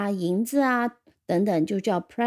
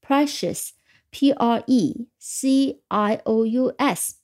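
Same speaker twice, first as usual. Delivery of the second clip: spelling it out; much slower than usual. Shown as a demonstration. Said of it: "再听一遍".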